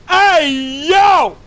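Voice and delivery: very high, loud hey yo; yelling at him. Real, not acted. Streamer shouting at a staring soldier, loud. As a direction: call out across the trench. Streamer yells at game character.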